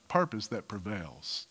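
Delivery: ordinary talk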